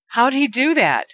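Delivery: high, startled shriek; lightly voiced frightened shriek; high scared question. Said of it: "Aunt Petunia shrieked".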